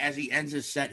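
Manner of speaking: ordinary talk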